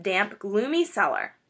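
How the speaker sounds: plain reading voice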